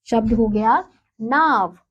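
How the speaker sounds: ordinary talk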